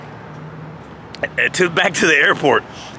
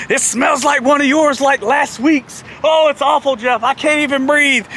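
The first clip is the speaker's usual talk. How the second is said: high, hammed-up complaint about a stink; mock disgust; 9 semitones higher than usual. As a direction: throw the blame back, feigning suffocation. Turns accusation back on Jeff.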